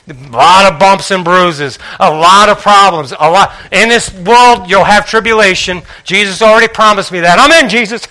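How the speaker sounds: loud, rising preacher's cadence, driving each phrase home; full-force preaching. Acted. Preacher rousing the congregation, loud and driving. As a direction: build the congregation up with every line.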